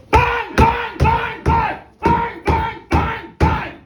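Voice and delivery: very high, very loud bang bang; shouted bangs. A narrator's reading. Narrator acting the clubs beating the men, shouted bangs. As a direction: shout each bang like a blow.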